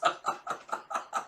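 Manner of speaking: trailing chuckles; real laugh. Real, not acted. Host still amused by the scene, laughing. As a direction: let the laughter die down.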